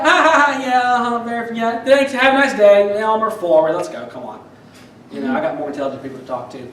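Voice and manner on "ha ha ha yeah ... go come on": fake polite brush-off. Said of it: "And I was like".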